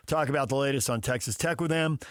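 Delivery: usual speaking voice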